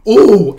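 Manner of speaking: loud, high exclamation of delight; excited "oh" cry. Real, not acted. Excited reaction to paused frame.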